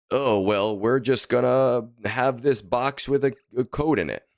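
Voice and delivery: loud sarcastic mimicry; mock the company, loud and high; mocking publisher voice.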